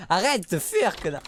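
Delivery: angry yell. Real, not acted. Yelling at the fleeing baby to stop. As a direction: bark at him to stop running.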